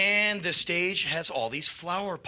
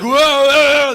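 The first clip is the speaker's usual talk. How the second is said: loud high "whoa"; imitated wobbling alarm; 8 semitones higher, 12 dB louder than usual. Amps sway under [name], loud alarmed wail. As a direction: yell as if toppling over.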